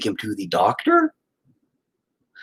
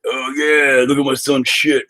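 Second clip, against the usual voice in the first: proud dad bro; 4 semitones higher than usual. Retold or imitated. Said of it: "guys being men are like".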